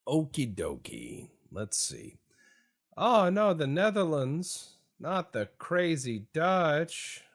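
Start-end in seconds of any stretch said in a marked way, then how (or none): 2.9-7.2 loud, high hammed-up alarm; mock dismay. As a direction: wail in pretend dread, dragging the end. Mock dread at a rival nation.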